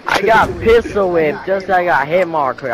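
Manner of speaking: loud, slow angry complaint; frustrated shout. Real, not acted. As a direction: yell it out in frustration. Angry gamer complaining after death.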